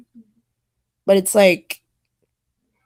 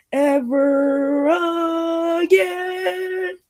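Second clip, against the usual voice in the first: rising high finish; 9 semitones higher, much slower than usual. Acted. Ends the chant climbing high.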